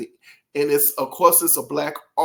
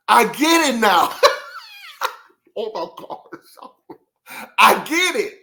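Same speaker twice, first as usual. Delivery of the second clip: laughing; 9 semitones higher, 5 dB louder than usual. Real, not acted. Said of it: "I don't know why I laughed at it".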